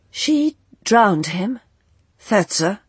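slow, stunned questions; disbelief, drawn out slowly; shocked. A narrator's reading. Arlo shocked at news.

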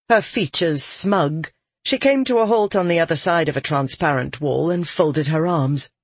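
plain reading voice

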